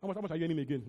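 ordinary talk

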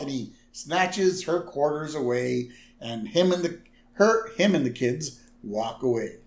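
ordinary talk

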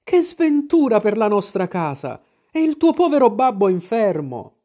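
high-pitched wail of dismay; distressed lament. A narrator's reading. Mother bewails misfortune on the house, high distressed cry. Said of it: "gridò mia madre".

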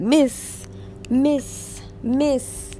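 put-on high, drawn-out calls of miss from pestering kids; calling kids. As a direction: mimic kids nagging for attention.

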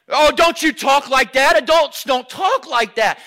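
loud mock reprimand; adult scolding. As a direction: cut in like a disapproving grown-up. Acted adult scolding the gushing child.

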